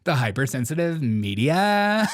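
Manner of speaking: hammed-up upspeak, MEDIA stretched and climbing; mock Valley girl. Host mimicking replayed clip, mock Valley girl. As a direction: swoop up loudly on the last word, Valley girl style. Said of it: "can you never do that again".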